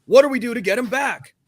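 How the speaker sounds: exec in crisis. Acted. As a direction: play a worried executive rallying the room. Acted panicked boss at Playmates meeting.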